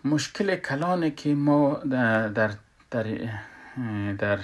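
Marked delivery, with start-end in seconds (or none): none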